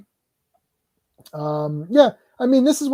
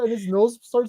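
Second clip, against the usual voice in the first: ordinary talk, nothing marked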